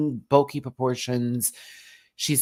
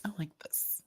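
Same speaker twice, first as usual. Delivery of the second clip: whisper; 5 semitones higher, 14 dB quieter than usual. Real, not acted. Whispered aside.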